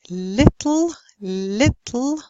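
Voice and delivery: "little" with clear first L, dark last L; clear then dark L. Shown as a demonstration. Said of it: "first L would be clear".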